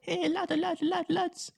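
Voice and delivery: squeaky, very high mock Japanese girl accent; terrible Japanese accent. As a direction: badly fake a tiny Japanese girl's accent. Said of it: "That was a terrible accent".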